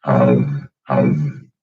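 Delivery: low rumbling ums; bass sounds demo. Shown as a demonstration. Demoing vocalized chest bass, low hums. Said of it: "if you add".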